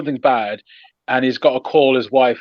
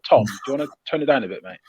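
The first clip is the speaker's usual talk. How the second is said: quick imagined aside; faster than usual. Retold or imitated. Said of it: "No one said".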